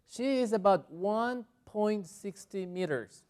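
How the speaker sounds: slow, measured English demonstration; slow model sentence. Shown as a demonstration. Teacher translating her example for students, slow and deliberate.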